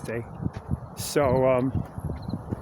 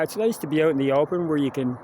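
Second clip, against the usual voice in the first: ordinary talk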